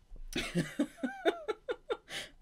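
long high squealing giggle; giddy giggle. Real, not acted. Reader can't stop giggling, high squeal. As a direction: keep giggling helplessly.

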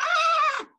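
very high wild scream; horror yell. Acted. Old man scaring beasts toward the giant with a very high scream. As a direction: let out a huge scream. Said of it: "let go of all his horror".

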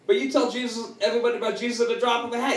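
high, rapid-fire objection; retold protest. Retold protest to his pastor.

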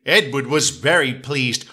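usual speaking voice

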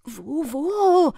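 slow, loud questioning; many voices asking loudly; eager crowd calls. Acted. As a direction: call out searching the crowd. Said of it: "fragten zwanzig Stimmen".

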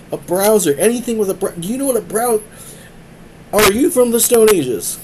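high, loud, disbelieving outburst; exasperated rant. Retold or imitated. Streamer scolding his friend about browsers, loud and incredulous. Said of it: "I'm like".

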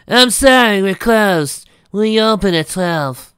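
put-on high, loud young mare. Mare answers the door.

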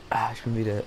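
ordinary delivery

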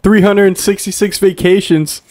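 mock reporter. Mock reporter challenging mayor.

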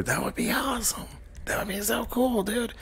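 high breathy whisper of delight. Real, not acted. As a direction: whisper it like a wish to yourself. Excited aside to stream chat.

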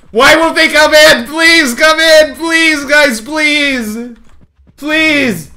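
high, begging shout at the pigs; desperate pleading. Real, not acted. Streamer begging stuck pigs to come inside, pleading shout. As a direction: plead with stubborn animals. Animals stuck outside the door.

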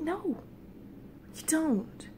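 high, soft, tender insistence; soft reassurance. Retold or imitated. Dreamer talking the lady gnome round, softly insistent. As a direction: insist gently in a small voice.

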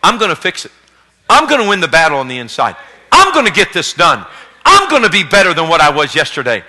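lightly voiced determined believer. Believers vowing to win the inner battle, a hint of resolve. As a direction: declare it like a vow.

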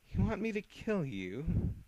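slow, high menacing voice; menacing threat. Acted. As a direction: threaten slowly.

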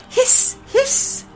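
high breathy hiss; goose hiss. A narrator's reading. Frightened goose hissing at the bear rug.

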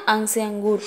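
usual speaking voice

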